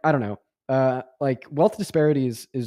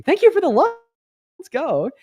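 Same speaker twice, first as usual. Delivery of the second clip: much higher, louder excited thank-you; hyped thanks; 7 dB louder than usual. Real, not acted. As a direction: burst out with hype for the donor.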